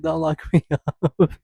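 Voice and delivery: high, loud, slow and laughing; laughing plea. Retold or imitated. Joking to the judge, a slow laughing high plea. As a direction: beg through giggles.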